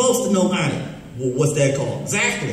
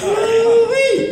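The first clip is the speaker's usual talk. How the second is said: high, loud whoop of glee; whooping Ooh-wee; 6 dB louder than usual. Acted. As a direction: let out a big hollering whoop. Puppet's signature whoop.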